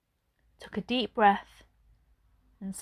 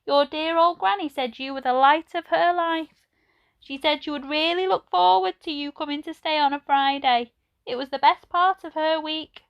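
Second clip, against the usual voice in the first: put-on old lady voice; 7 semitones higher, 3 dB louder than usual. A narrator's reading. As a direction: voice her like a gentle elderly lady. Edna tells Ben of Granny.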